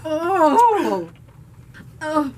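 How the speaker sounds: loud groans at the sourness; eating sour candy. Real, not acted. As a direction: wince out loud at the sour taste.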